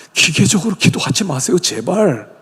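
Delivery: ordinary talk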